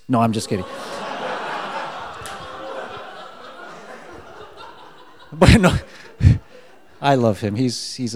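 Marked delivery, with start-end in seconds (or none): 0.7-4.7 breathy laugh at own joke; long, soft-edged laugh; laughter. Real, not acted. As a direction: laugh it off at length.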